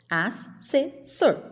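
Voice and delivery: "Assessor" slowly in three separate beats; crisp pauses between as, ses, sor; syllable by syllable. Shown as a demonstration. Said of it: "Viu como ficou?".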